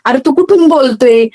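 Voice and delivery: ordinary talk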